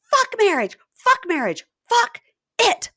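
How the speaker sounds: high, loud mock screaming; acted furious interruption. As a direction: shriek each burst as the interrupting girlfriend. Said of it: "she interrupts and goes".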